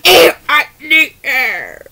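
slow, strained plea for air; hammed-up choking voice; pretend choking. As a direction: gasp it out as if being choked. Acts being choked, begging for air.

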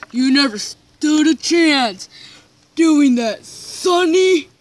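loud, rising villain taunt; mock gloating. Attacker gloats at Sonny after winning, loud taunt. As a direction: gloat loudly over a beaten foe. Winner gloats over beaten foe.

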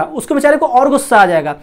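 ordinary talk

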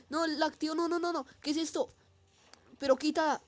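high panicked no no no; frantic, high-pitched panic. Real, not acted. Panic as enemy appears.

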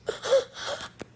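high breathless gasp of a starstruck fan; imitated gasp. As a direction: copy the fan's shocked intake of breath. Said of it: "And she looks at me and goes".

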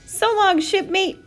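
high, called-out goodbye; loud, high farewell hail; crying farewell. A narrator's reading. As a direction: call goodbye to a shipmate. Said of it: "cried Pete".

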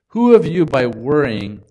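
thrown out loud to challenge the listeners; booming preacher question. Acted. Preacher reading Jesus' words aloud.

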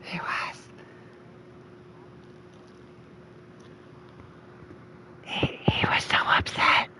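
breathy unvoiced whisper of mock concern. Real, not acted. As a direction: whisper it as a hushed aside. Whispering aside about Lewis being upset.

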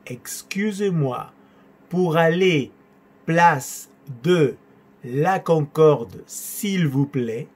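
very slow, broken into short chunks; drawn-out syllables with pauses between phrases; slow teaching model. Shown as a demonstration. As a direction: dictate it piece by piece for learners.

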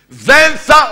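loud, high call to the crowd. Real, not acted. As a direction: lead the congregation in. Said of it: "όλοι μαζί". (καλεί το εκκλησίασμα δυνατά να πει μαζί)

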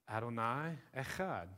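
soft Hebrew Adonai Echad; Hebrew phrase. Shown as a demonstration. Preacher quoting scripture, softly saying Adonai Echad in Hebrew. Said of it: "So he says".

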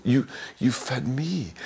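ordinary delivery

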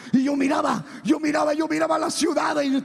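high, loud preaching shout; exclamación exaltada. Real, not acted. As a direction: shout it in awe, repeating insistently. (gritando exaltado, con voz alta)

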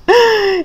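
high, tearful gasp; emotional gasp. Acted. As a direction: gasp as if near tears. Pig overwhelmed by kindness.

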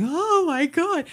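high, drawn-out "oh my God"; retold shock. Viewer recalls the scene that tipped her over, overwhelmed. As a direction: stretch it out high, reliving being overwhelmed.

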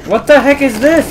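real baffled outburst; outraged shout. Real, not acted. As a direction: yell at the screen in disbelief. Baffled by game event.

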